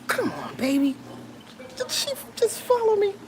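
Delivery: cooing to a pet who followed her; pet talk. Real, not acted. Talking to her pet Luce.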